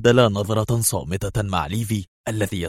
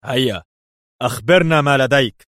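louder, higher-pitched sharp demand; sharp shout; 5 semitones higher, 5 dB louder than usual. A narrator's reading. Interrogator pressing the informant to talk, sharp and raised. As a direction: call out an impatient order. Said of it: "فصاح هذا الأخير في حدة".

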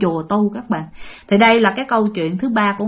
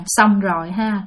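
ordinary talk, nothing marked

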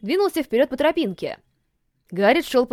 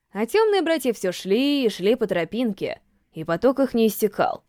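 ordinary delivery

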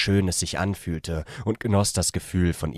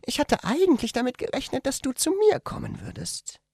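much higher female character voice; young woman speaking softly. A narrator's reading. The woman tells him in bed, in a much higher female voice. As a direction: voice her as a shy young woman, gently. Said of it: "Sie sagte leise".

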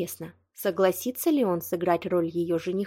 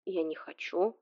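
softer, slow, careful refusal; cautious; 7 dB quieter than usual. Acted. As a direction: push back gently and warily. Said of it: "осторожно воспротивился Витя".

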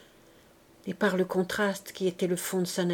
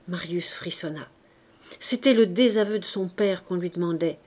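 ordinary delivery

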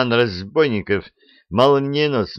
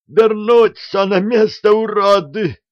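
high, loud panicked shriek; 13 semitones higher, 7 dB louder than usual. Acted. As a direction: screech orders at the gang. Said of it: "заверещал атаман".

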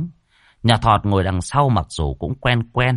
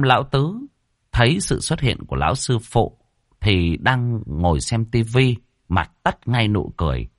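ordinary delivery, nothing marked

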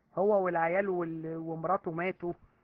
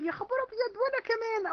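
much higher, squealing mock excitement; mock gushing woman; 12 semitones higher than usual. Preacher mocking a woman copying others, high mock gush. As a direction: squeak it like a copycat fan. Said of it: "هقوم انا اقول".

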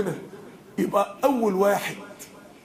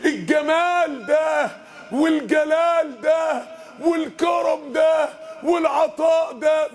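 slow, high, drawn-out exclamations; awed praise; 6 semitones higher, much slower than usual. Acted. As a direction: savour each word in wonder. Preacher extolling God's attributes. (تمجيد بطيء مرتفع بانبهار)

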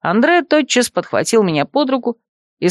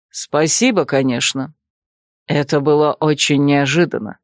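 put-on lower, composed older man; lower male voice; 5 semitones lower than usual. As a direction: voice him lower and calm. Andre speaks.

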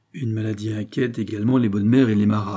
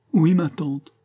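higher tearful yes; weepy, pitched-up admission; sobbing; 6 semitones higher, 4 dB louder, slower than usual. A narrator's reading. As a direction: whimper the answer, lightly acted. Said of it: "les sanglots de Pitou redoublèrent".

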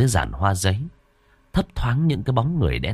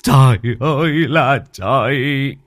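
muttered lament; 4 semitones higher, 3 dB louder, slower than usual. Acted. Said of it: "mà lẩm bẩm".

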